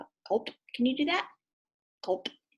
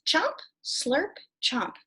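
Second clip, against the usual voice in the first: higher, punchy chomp and slurp; chomp slurp sound; 5 semitones higher, much slower than usual. Shown as a demonstration. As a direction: act out eating noisily. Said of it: "Ready? You do it".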